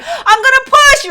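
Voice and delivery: high, loud, drawn-out cry; retold shout, slow and high; desperate announcement. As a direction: wail it out loudly. Retold declaration in labour.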